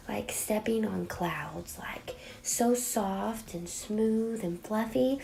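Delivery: slow soft whisper; dreamy whisper. Acted. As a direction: breathe it out like a secret. Dreamy description of blending.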